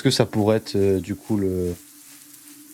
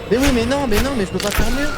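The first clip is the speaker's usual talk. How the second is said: high, louder frustrated protest; exasperated high outburst; 14 semitones higher, 5 dB louder, faster than usual. Real, not acted. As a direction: squeal in frustration.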